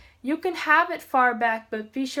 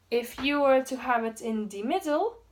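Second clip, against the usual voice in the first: ordinary talk, nothing marked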